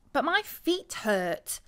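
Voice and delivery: slow, whiny moan; complaining.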